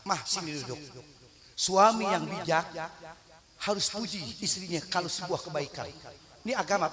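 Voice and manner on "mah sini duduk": softer, lower gentle invitation; husband gently calling his wife over; tender call. Retold or imitated. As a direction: coax her to sit beside you.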